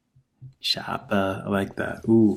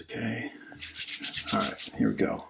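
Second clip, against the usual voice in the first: ordinary talk, nothing marked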